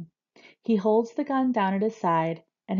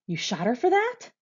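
mild yell, rising high at the end; high, incredulous question; disbelieving yell; 5 semitones higher, 3 dB louder than usual. A narrator's reading. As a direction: ask it in raised-voice disbelief. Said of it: "the voice yells".

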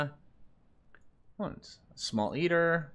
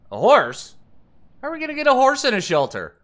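loud, high-pitched disbelief; incredulous; 10 semitones higher, 7 dB louder than usual. Real, not acted. Gamer reacting to a horse offered as a shelter pet, incredulous. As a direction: question it in real disbelief, rising high and loud.

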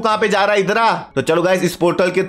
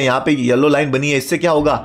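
usual speaking voice

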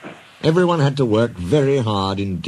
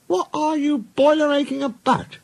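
very high character voice; scornful engine. Acted. Duck challenging the grumbling engines, scornful. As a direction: challenge them in a high put-on voice.

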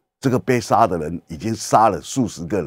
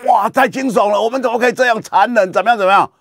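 shocked journalist; 10 semitones higher than usual. Retold or imitated. Journalist appalled by the execution photo, high horrified outcry. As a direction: gasp it out like an appalled reporter. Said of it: "这记者，哇".

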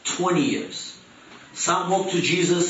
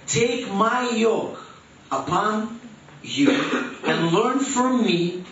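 slow deliberate reading; 5 semitones higher, slower than usual. Acted. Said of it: "He continues His thought".

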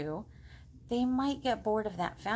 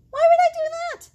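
high, loud indignant squawk; shocked frog; 14 dB louder than usual. Acted. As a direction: shoot up high and loud in disgust. Frog outraged at pig.

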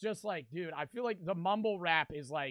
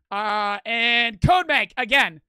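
drawn-out hype shout; 3 semitones higher, 10 dB louder, much slower than usual. Real, not acted. Drawn-out loud thanks.

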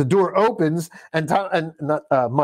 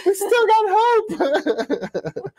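laughing parody; much slower than usual. Real, not acted.

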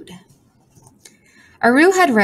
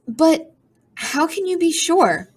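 put-on high, slow young voice; high slow young girl; doubtful girl; 9 semitones higher, slower than usual. A narrator's reading. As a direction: press the bird for proof. Aru questions the bird.

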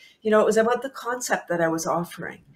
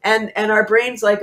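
ordinary talk, nothing marked

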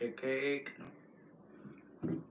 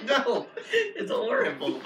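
slow, high, laughing groan; laughing disgust; 11 semitones higher, slower than usual. Real, not acted. After tasting the rotten egg bean.

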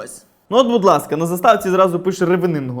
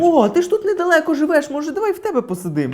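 high-pitched chirpy guest angling for an invite; breezy self-invitation in a raised voice; eager guest; 7 semitones higher than usual. Acted. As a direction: play a pushy visitor, pitched up. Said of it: "коли гості кажуть".

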